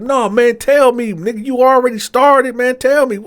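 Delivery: insistent demand. Retold or imitated. Pressing his friend for the news, loud and insistent. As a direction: demand it, won't take no.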